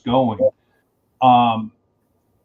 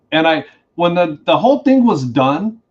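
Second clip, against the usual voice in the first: usual speaking voice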